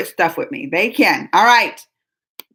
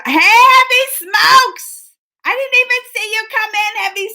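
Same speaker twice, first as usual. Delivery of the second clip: calling out; 10 semitones higher, 5 dB louder than usual. Real, not acted. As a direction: sing out a name to a friend. Greeting viewer by name.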